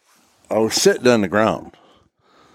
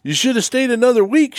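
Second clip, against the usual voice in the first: much higher, lightly voiced wife; imitating wife. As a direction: squeak out the wife's puzzled question. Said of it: "She goes".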